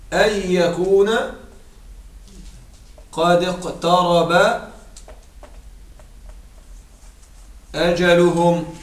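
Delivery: slow, measured chanting of the verse; Quranic recitation. Shown as a demonstration. Teacher reciting a Quran verse for the grammar lesson, slow and chanted. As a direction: draw each word out as in recitation.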